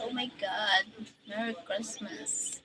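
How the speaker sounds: ordinary delivery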